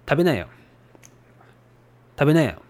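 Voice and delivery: standard Tokyo "Tabenayo"; Tokyo dialect. Shown as a demonstration. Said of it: "In Tokyo dialect".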